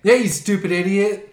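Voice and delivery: mock angry insult. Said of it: "mocking the angry goalie".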